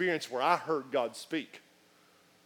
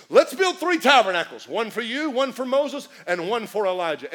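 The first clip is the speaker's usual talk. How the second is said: loud, high, eager Peter bursting in; eager loud blurt; 5 semitones higher, 7 dB louder than usual. Acted. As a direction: blurt it out, too excited to wait.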